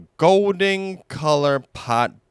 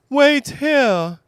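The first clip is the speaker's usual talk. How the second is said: Pooh voice; 13 semitones higher, 4 dB louder than usual. Acted. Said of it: "he said".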